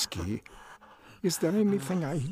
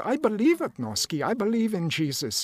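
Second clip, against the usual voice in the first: higher, urgent conviction; rising earnest plea to a crying friend; earnest pleading; 7 semitones higher than usual. Acted. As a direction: beg him to believe too.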